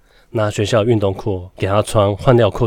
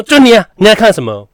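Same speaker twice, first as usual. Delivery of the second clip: high, loud sharp scolding; angry teacher yell; 13 semitones higher, 11 dB louder than usual. Retold or imitated. Teacher singling out the boy, loud and high. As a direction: snap at the kid who looks around.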